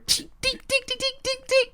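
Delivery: high, rapid ticking noises; sharp little mouth-made pings; imitated pellet hits. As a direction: tick out pellets striking metal. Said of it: "and then we hear".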